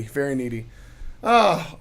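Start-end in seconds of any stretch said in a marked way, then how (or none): 0.0-0.7 much softer, lower aside; quiet. Shown as a demonstration. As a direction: drop the voice to a murmur. Repeats phrase, then fades.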